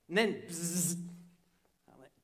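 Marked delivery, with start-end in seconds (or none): 0.5-1.0 drawn-out "bzzz" buzzing noise; imitated cicada buzz. Cicada on the storyteller's shoulder, imitated buzz.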